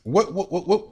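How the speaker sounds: ordinary delivery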